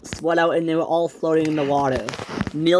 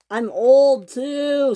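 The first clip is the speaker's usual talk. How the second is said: whimpering; 9 semitones higher, 4 dB louder, much slower than usual. A narrator's reading. Linnaeus wails in the water.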